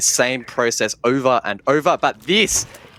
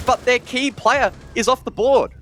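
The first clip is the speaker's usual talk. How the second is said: excited shout; 11 semitones higher than usual. Real, not acted. Big play, caster erupts.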